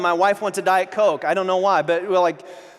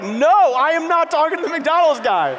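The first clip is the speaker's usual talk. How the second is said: high, loud comic protest; loud mock refusal; 8 semitones higher, 5 dB louder, slower than usual. Speaker refusing God's request at the drive-thru, loud mock protest. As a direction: cry out an indignant no, half laughing. Acted refusal argued with God.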